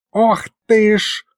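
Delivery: high, drawn-out startled exclamation; sudden gasp. A narrator's reading. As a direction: gasp it out in alarm. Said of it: "ахнул вдруг Михалыч".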